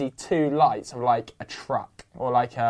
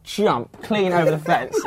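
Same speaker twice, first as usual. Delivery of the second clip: normal talking voice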